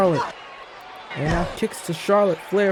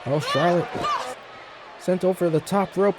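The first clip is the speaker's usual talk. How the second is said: ordinary talk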